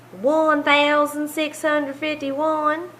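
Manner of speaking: slow Southern drawl on the number; southern accent. Shown as a demonstration. Teacher demonstrating the number in a Southern accent. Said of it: "See if you can say that with a southern accent".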